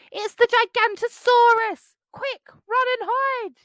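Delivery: high, loud alarm cry; cried out. Acted. Bonehead yelling to his friends, high loud alarm. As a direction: shout the warning in a panic. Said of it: "Bonehead cried".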